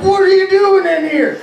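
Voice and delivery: very high mock holler; retold outraged shriek; indignant drunken yell. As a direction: yell at an intruder, hammed-up. Drunk brother shouts at intruder.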